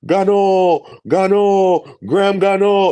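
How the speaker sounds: high, loud soccer announcer call; Spanish broadcast call. Retold or imitated. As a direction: belt the name like a goal call. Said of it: "They did the Spanish broadcast".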